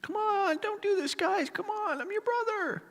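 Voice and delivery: very high, thin mock yell; distant-sounding high cry for mercy; scared pleading. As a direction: plead desperately with your brothers, without full volume. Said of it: "He's probably yelling at his brothers".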